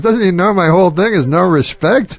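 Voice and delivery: high-pitched mock outrage; retold indignant outburst. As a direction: imitate an affronted comic complaining on the phone. Retelling comic's angry phone outburst.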